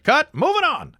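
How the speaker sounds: high, loud curt director call; brisk director. Retold or imitated. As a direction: call it fast and done. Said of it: "director's like".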